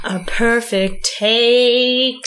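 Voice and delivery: drawn out slowly. Pretend. Savouring the perfect take.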